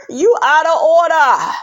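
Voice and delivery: high, emphatic telling-off; indignant scolding. Real, not acted. Viewer scolding him over remark to mother-in-law, indignant.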